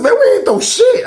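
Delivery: very high, loud squeal of denial; mock protest. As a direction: act out innocence in a squeaky, laughing protest. Acted denial of the accusation.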